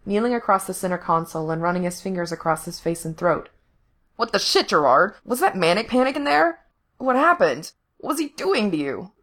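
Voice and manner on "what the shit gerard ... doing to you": high-pitched, swooping alarmed questions; frantic, voice cracking. A narrator's reading. Rescuer questions his rescued friend, frantic. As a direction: fire off questions, pitch leaping in panic. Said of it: "His voice cracked, still half frantic".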